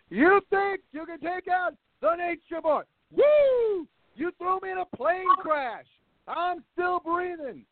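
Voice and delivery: high, brash Ric Flair-style yell; wrestling promo. Retold or imitated. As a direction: strut through it like a boastful wrestler. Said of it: "Rick cutting a promo on, on death".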